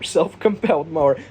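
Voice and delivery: repeating "Self-compelled mower" with a laugh; laughing through "Self-compelled mower". Shown as a demonstration.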